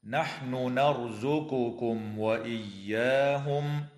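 slow chant, each word clear; Quran recitation. Shown as a demonstration. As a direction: intone the phrase deliberately. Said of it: "قوله تعالى".

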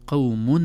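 قوم with a clear qawmun, the nun sounded; nun pronounced clearly. Shown as a demonstration. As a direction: make the nun of قوم heard. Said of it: "النون ظهرت".

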